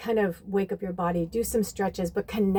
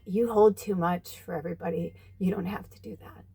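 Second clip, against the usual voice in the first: soft, hushed, higher comforting voice; tender reassurance; 4 semitones higher, 5 dB quieter than usual. Retold or imitated. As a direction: soothe a crying friend gently. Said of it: "she's like".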